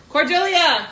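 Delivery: loud call to another room; yelling across the room. Real, not acted. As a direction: shout her name across the house. Calling daughter across house.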